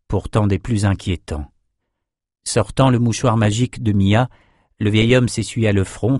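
plain reading voice

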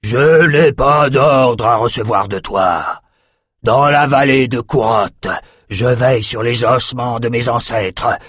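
lent et solennel, un dragon fier; voix tonitruante de dragon. Acted. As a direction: refuse avec une fierté ancienne. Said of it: "Sa voix tonitruante". (voix de dragon lente et fière)

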